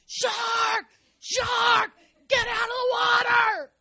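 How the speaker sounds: high, loud alarmed shout; lifeguards shouting. Retold or imitated. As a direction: yell the warning down the beach. Lifeguards calling swimmers out.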